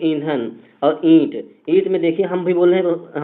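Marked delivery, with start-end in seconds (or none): 0.0-1.3 slowly, ईंधन through the mouth, ईंट nasal; slow demo. Shown as a demonstration. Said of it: "try करिए".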